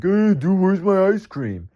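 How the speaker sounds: loud, high, drawn-out mock demand; demanding customer. Man who threw up wants his ice cream, mock indignant demand. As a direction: call out high and slow, imitating an indignant customer.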